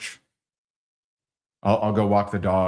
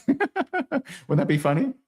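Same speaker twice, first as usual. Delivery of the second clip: laughing; 13 semitones higher than usual. Real, not acted.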